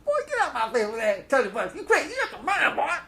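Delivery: high, loud cartoonish mock [name]; a hammed-up squeaky Kurt haggling. Haggling with the seller as a mock [name], high and loud. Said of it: "That's [name]. That's how he talks".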